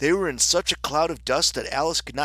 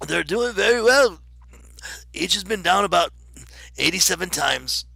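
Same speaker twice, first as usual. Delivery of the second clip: loud, higher, choked delivery; choking voice; 6 semitones higher, 6 dB louder than usual. A narrator's reading. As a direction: speak as if food is stuck in your throat. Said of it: "he said in a choking voice".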